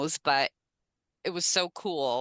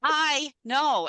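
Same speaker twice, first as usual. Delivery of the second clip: excited; 9 semitones higher, 4 dB louder than usual. Real, not acted. Still gushing about the story.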